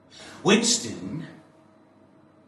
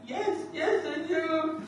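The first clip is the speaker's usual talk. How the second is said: high, soft, laughing despair; broken prisoner; 14 semitones higher, 9 dB quieter than usual. Acted. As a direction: crack into frightened laughter. Winston broken under torture.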